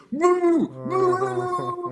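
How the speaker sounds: long high muffled hoot, held on one note; loud, high-pitched "mooo" wail, imitating a panicked diver; imitated underwater screaming. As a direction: mimic screams coming through a diving regulator. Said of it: "You hear 'em through the regulator".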